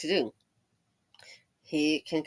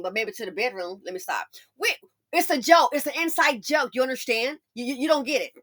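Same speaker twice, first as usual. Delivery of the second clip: ordinary talk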